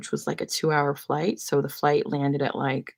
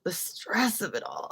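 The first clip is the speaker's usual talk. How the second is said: slow breathy whisper, dropped soft; 3 semitones higher, 6 dB quieter than usual. Shown as a demonstration. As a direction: whisper it like a secret.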